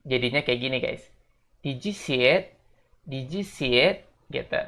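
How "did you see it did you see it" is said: "Did you see it" with "did you" blended into "didju"; linked "did you". Shown as a demonstration. Teacher models the linked question slowly. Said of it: "Jadi disambung semua itu".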